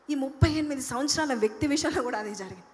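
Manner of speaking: normal talking voice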